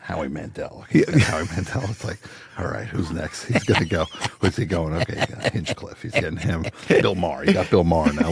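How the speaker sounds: hushed play-by-play. Retold or imitated. Hushed running tally of guests.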